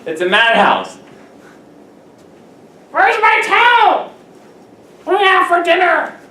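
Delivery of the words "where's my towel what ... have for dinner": mock shrill housemate. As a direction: play a needy housemate yelling through the house. Crowded house voices.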